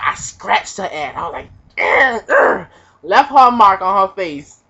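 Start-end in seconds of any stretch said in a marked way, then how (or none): 1.8-2.7 effort grunts. Retold or imitated. Scratching her face.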